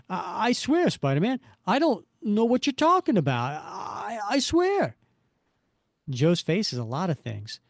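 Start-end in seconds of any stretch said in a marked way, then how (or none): 0.1-5.0 high-pitched, stammering plea; shaking coward. Acted. Coward swears innocence to the hero, shaking. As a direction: rise to a high yelp of denial. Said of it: "The way he's shaking".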